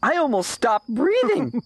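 put-on high-pitched kid, giddy after a scare; relieved excitement. Arthur after the pizza scare, relieved and excited.

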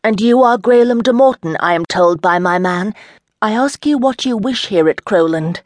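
a lady hailing a knight from her horse, loud; calling out across the field. Acted. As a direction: call out boldly to the stranger. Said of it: "She called out".

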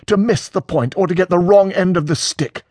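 high-pitched bitter reproach. A narrator's reading. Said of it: "says Peter bitterly".

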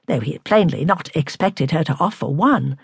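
usual speaking voice

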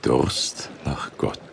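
whispered, slow repetition; soft echo. Acted. Paulus softly echoing Lydia's words, moved. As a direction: savor her words under the breath.

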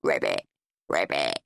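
low croaked ribbit; croaking frog. A narrator's reading. Henry croaking like a frog at the table. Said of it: "croaked horrid Henry".

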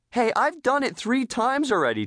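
high-pitched protest; indignant declaring. A narrator's reading. Tina refusing the teacher, indignant. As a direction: push back, hands on hips. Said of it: "Tina declared, her fins on her hips".

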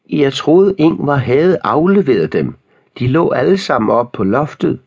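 put-on old lady's shout. A narrator's reading. Old lady explaining overdue books, high-pitched shout. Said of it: "Hun råbte".

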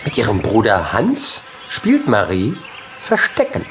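ordinary delivery, nothing marked

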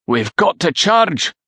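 high, slow urgent call; urgent battle shout. A narrator's reading. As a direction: call out for the charge, drawn out. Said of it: "Coinach shouted to Rory".

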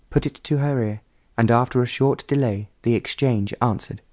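plain reading voice

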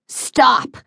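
sudden high-pitched outburst; sharp command. A narrator's reading. Father stopping his son mid-threat, sharp command. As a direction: cut him off with one cry.

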